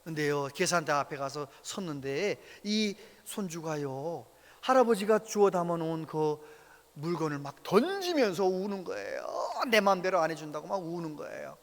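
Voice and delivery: normal talking voice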